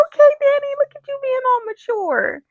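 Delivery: very high, loud teasing squeal; exaggerated high-pitched cooing at someone; mock praise. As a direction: gush it way up high, as playful praise. Teasing congratulation to a character.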